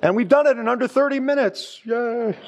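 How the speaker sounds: high, sing-song sarcastic yay; mock cheer. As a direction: fake delight at the quick finish. Mock celebration of short sermon.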